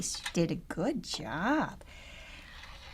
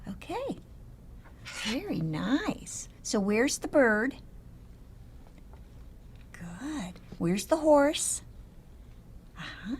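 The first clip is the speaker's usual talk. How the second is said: put-on reading to a toddler. Picture naming with toddler.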